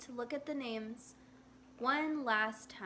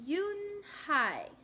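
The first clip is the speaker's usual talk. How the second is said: "Yoon-hae" said slowly, syllable by syllable, pitched up; slow careful; 7 semitones higher, 3 dB louder than usual. Acted. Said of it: "Joey said slowly".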